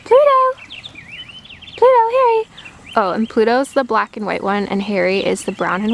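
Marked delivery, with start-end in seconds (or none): 0.0-2.4 high, loud call to the dogs. Real, not acted. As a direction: call the dogs back from afar. Calling the dogs.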